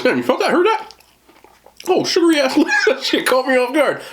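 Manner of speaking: high, startled and laughing; surprised. Real, not acted. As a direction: caught off guard, squealing up high. Surprised by a crunch.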